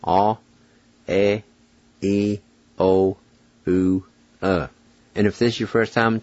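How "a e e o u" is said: each vowel "A, e, E O U" held alone as a pure sound; vowel sounds demo. Shown as a demonstration. Teacher shows learners the six vowels, isolated pure sounds. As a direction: sound out "A, e, E O U" one by one, evenly. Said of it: "here are six tricky vowel sounds".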